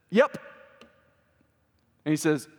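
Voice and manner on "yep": high, punchy one-word reply; punchy yes. Retold or imitated. Jesus confirming his disciple's answer, high and punchy. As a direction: pop it out high.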